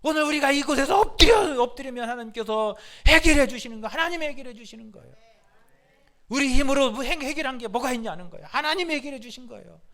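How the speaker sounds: high, loud bursts on key phrases; impassioned preaching. Real, not acted. Preacher urging congregation that God solves it, impassioned. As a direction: preach it with rousing force.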